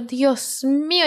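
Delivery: normal talking voice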